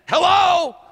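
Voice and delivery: loud sarcastic "Hello?"; exasperated shout. Acted. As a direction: call it out at the crowd. Exasperated comic call-out.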